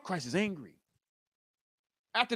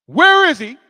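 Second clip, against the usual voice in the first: loud, high angry question; mad demand; 5 semitones higher, 10 dB louder than usual. Acted. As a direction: demand it as a furious Christ. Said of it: "and gets mad".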